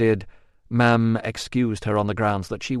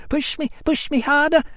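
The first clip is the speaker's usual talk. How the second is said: put-on child shouting. A narrator's reading.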